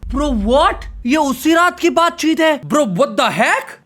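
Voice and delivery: high-pitched, stunned disbelief; real astonishment. Real, not acted. Streamer learning it is the same night, astonished. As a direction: react to a twist you did not see coming. Streamer reacts to shocking twist.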